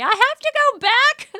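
very high, loud wail; appalled mock shriek. Daughter after her first day of kindergarten, mock appalled wail. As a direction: imitate a child aghast, loudly.

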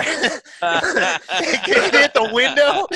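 laughing through the words, slow and high; cracking up mid-sentence. Real, not acted. Laughing while telling friends about the crash.

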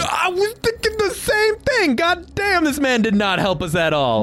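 shouted. Real, not acted. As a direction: belt out the mock agreement. Sarcastic outburst at Otto.

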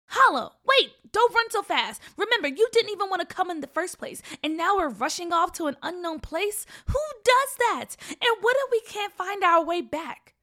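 high, loud, worried protest; alarmed scolding. Acted. Sister chasing brother across the tracks, alarmed scolding. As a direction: call after him, loud and pitched high.

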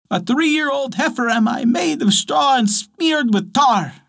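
a simple, sing-song ox answering the bear; straw ox voice. Acted. Ox figure voiced.

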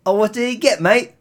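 high jokey British voice; British accent. Pretend. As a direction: play a cheeky Brit. Mock British reply to story.

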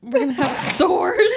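said through laughter, high and slow; giggling the words out; laughing. Real, not acted.

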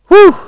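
big whew of relief; breathless exhale. Real, not acted. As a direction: blow out a long breath. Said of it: "I'm running out of breath".

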